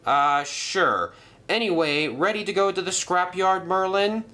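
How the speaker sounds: sceptical Madge. Acted. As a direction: humour him and move on.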